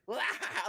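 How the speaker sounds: high, put-on villain cackle; evil villain laugh. Showing how Joker and bad guys laugh, villain cackle. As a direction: cackle like a movie bad guy. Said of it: "the evil bad guys always do that laughing".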